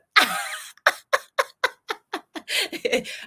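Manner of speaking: high, drawn-out laugh; real laugh rising high; long laugh. Real, not acted. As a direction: laugh it off at length. Laughing at own reading joke.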